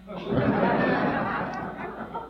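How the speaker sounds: high, airy burst of laughter; high giggling laugh at the absurdity; real laugh. Real, not acted. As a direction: laugh at your own cluelessness. Laughs at his own answer.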